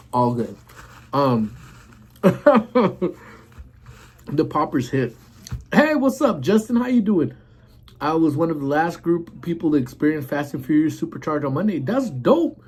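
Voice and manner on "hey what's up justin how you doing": high, bright hello to a viewer; excited greeting. Real, not acted. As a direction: greet him with a big lift.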